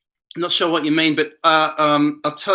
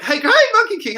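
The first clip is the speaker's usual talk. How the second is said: high, bright-up hello to a viewer; excited greeting; 14 semitones higher, 3 dB louder, faster than usual. Real, not acted. Streamer greeting a chat viewer, excited high call. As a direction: call out the name like spotting a friend.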